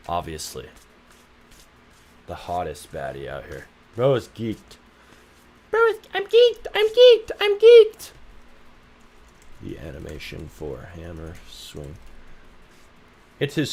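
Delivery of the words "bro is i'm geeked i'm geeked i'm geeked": very high, louder put-on squeak; cartoonish high-pitched squeal; squeaky voice. Squeaky voice repeating.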